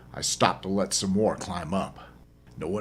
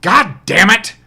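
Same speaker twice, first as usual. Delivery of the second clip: loud shout, pitched much higher; startled curse; 13 semitones higher, 15 dB louder, slower than usual. Acted. Narrator finds a stranger in his truck, shouting curse. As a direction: blurt it out in a furious shout.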